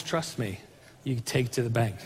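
a calm, soft promise; reassuring. Acted. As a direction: reassure them gently. God's assurance, softened.

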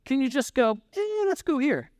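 high, disbelieving questions; rising into a squeaky mock of the pilot; incredulous. Traveler marveling at the pilot's detour, high incredulous. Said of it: "I was thinking to myself".

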